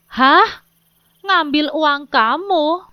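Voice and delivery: shocked wife throws the question back, slow. Acted. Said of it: "dengan suara yang intonasinya lebih tinggi". (istri kaget, nada suara lebih tinggi)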